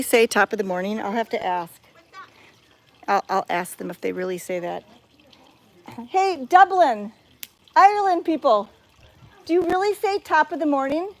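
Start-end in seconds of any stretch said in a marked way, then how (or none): 6.1-11.1 calling out. Real, not acted. Calling out to Dublin visitors.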